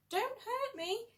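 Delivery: high, frightened whimpering plea; a big lion begging, scared stiff. Acted. Frightened lion whimpers to the little mouse. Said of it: "he whimpered".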